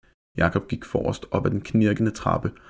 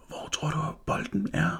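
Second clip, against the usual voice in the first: hushed, voiceless question, a little higher; a scared boy whispering to his friend; 9 semitones higher, 6 dB quieter than usual. Acted. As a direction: whisper it in the dark house. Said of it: "hviskede Mathias".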